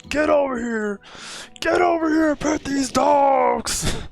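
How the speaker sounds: high shouted game battle cry; Scorpion voice. Retold or imitated. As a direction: bellow it like a fighter. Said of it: "that Scorpion's voice from M K".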